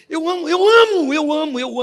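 loud, high burst of joy on amo; excited outburst. Real, not acted. The preacher tells the congregation he loves God's word, exuberant. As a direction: cry it out with delight.

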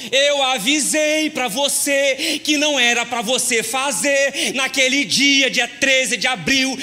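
loud, slow "I told you so" in mock wife voice; imitating the wife. Pastor imitating wife scolding him, loud and slow. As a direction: drag out the date like a scolding wife. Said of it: "ela falou".

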